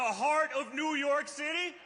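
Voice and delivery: building to a rally shout. Real, not acted. Rally climax, crowd roaring.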